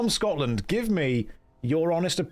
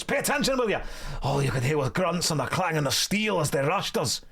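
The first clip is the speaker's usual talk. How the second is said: hammed-up Scottish dwarf storyteller; Scottish accent. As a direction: spin the tale in a broad put-on Scottish accent. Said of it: "That was a perfect Scottish accent".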